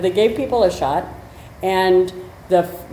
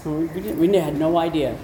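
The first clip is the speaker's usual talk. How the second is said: ordinary delivery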